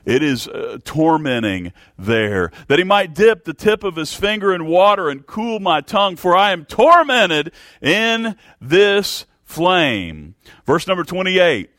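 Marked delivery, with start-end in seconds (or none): 2.7-10.3 high, loud pleading cry from hell; desperate cry. Acted. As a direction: beg for mercy at the top of your voice, desperate. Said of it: "He was desperate".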